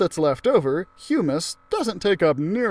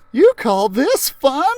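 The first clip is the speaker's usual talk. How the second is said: put-on much higher, louder, slow worried kid voice; a scared girl clutching her seat, high and loud; Phoebe, nervous; 11 semitones higher, 8 dB louder than usual. Phoebe asking Miss Frizzle if this is fun, high and anxious.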